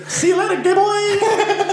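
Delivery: high, loud cartoon Italian accent; shrieked mock-Italian game catchphrase. Showing friends what the game says, high Italian accent. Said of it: "trying to do an Italian accent".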